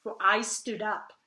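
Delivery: measured, emphatic poem reading with falling line ends; poetry recitation. Acted. Reader reciting Emily Dickinson's poem about despair, poetry recitation. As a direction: recite it line by line, stressing key words.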